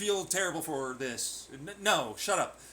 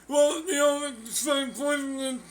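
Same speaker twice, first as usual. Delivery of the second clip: flustered mock voice; 8 semitones higher, 5 dB louder, slower than usual. Flustered caught persona.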